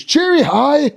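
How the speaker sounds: high, drawn-out sing-song; chirpy elf greeting. Acted. Teaching everyone the new elf word, chirpy. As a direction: chirp it like a theme-park elf.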